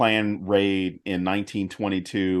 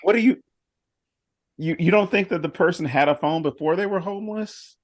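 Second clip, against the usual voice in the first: incredulous; 6 semitones higher than usual. Retold or imitated. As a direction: ask it in raised disbelief. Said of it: "I'm like".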